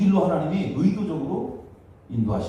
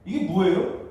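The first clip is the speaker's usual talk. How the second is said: acting out indignant, high-pitched accusations; resentful complaint; 6 semitones higher, 4 dB louder than usual. Israelites blame God and Moses for leading them to die, resentful. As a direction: protest loudly as the wronged people. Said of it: "원망한 거예요".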